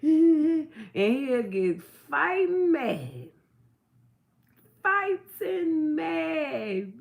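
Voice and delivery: laughing through the words, drawn out slowly. Real, not acted. Mother laughing about her son getting fighting mad, slow laughing. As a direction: giggle as you tell how mad he gets.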